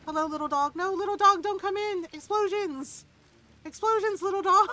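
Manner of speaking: alarmed shouting at dog. Real, not acted.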